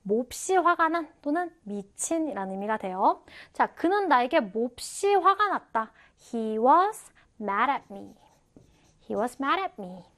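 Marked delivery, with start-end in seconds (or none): 6.3-8.2 "He was" drawn out, then "mad at me"; careful, stretched teaching read of "He was mad at me"; slow model sentence. Shown as a demonstration. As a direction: say "He was mad at me" slowly in two chunks. Teacher models sentence slowly first.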